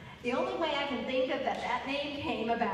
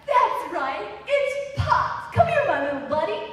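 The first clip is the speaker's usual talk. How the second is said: much higher, louder, fast jolly dad; jolly American dad; 6 semitones higher, 9 dB louder than usual. Retold or imitated. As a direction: ham up a cheerful buddy dad. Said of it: "an American dad would be like".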